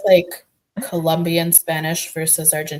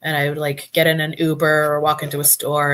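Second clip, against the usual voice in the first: normal talking voice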